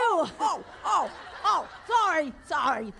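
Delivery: flustered apology. Retold or imitated. Caught out by the cop.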